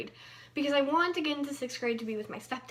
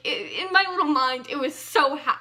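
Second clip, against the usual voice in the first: ordinary talk, nothing marked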